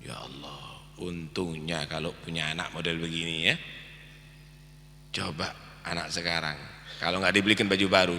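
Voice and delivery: almost whisper the reflection; hushed aside. Real, not acted. Preacher musing to the congregation, very soft and low.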